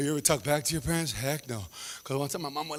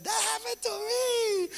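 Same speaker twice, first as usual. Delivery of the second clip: high excited squeal; slower than usual. Retold or imitated. Audience recognizing themselves.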